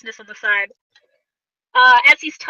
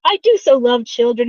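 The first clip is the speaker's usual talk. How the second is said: ordinary talk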